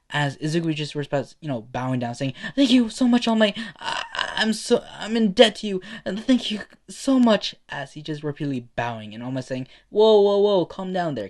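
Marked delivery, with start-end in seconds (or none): 2.6-7.5 high, loud, stammering gratitude; gushing thanks. Acted. Fan gushing thanks while bowing. 9.9-11.3 loud, high whoas easing down; calming someone down. Acted. Hero calming an overexcited kid.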